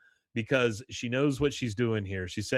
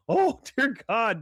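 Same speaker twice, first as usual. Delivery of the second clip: high, loud, slow exclamation; mock shock; 11 semitones higher, 5 dB louder than usual. Irish guests watching the beating, mock horrified exclamation. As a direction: draw it out in acted horror. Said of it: "the Irish people are like".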